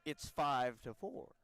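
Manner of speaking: very slow mimicked score call; Reeves Jackson. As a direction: echo the announcer with exaggerated, stretched inflection. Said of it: "it's definitely an inflection".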